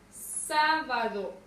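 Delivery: SÁ alargada y fuerte en «sábado»; acento en SÁ. Shown as a demonstration. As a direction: di «sábado» lento, cargando la voz en SÁ. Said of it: "¿Dónde recae la mayor fuerza de voz?". («sábado» con la primera sílaba marcada)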